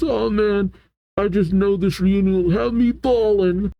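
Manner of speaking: high, drawn-up wail without volume; emotional whine. Acted. Frankie anticipating the reunion, high mock-tearful voice. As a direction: play someone about to bawl, high-pitched.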